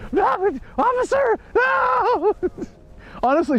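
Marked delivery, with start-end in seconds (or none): 0.1-2.3 very high mock shout of a frantic woman; hammed-up shrill begging; frantic drunk lady. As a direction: plead wildly like a drunk lady. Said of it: "she was going frantic".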